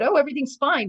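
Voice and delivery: high, loud mock-chirpy everything's fine; parroting the host's brave face; mock cheerful denial. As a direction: imitate her brushing it off brightly. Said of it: "So even though she said".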